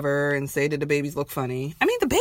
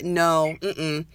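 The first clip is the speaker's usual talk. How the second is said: ordinary talk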